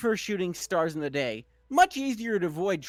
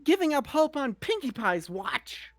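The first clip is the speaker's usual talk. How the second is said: peppy pony voice; 7 semitones higher than usual. Acted. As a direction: cheer her on like an overeager pony. Pinkie Pie cheering Twilight on.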